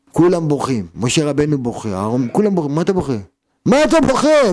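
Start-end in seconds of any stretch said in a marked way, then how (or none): 3.7-4.5 high, loud challenge; shouted rebuke. Acted. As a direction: demand it at full voice. Rebuking the weepers, acted out.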